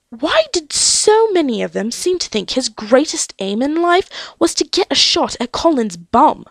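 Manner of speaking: ordinary delivery, nothing marked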